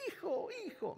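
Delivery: very high, excited imitating mother; eager mother. Mother interrupting her son, very high excited voice. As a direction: cut in, overjoyed. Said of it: "me interrumpió ella".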